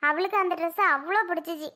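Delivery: ordinary talk, nothing marked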